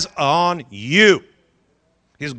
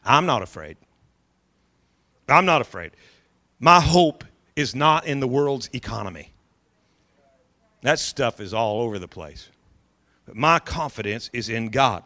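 much lower, softer calm assurance; quiet confidence; 8 semitones lower, 6 dB quieter than usual. Acted. Believer speaking of trust in God, quiet low confidence. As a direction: say it low and sure. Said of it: "say with confidence".

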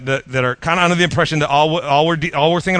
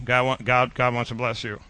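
mock timid, much softer and lower; unsure question; 5 semitones lower, 9 dB quieter than usual. Mimicking a congregation's doubtful, hushed mumble. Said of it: "say that like it's a question".

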